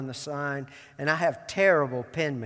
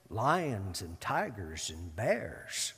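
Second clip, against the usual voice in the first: Tin Man voice; 7 semitones lower, 8 dB quieter, much slower than usual. Retold or imitated. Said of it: "And the Tin Man says".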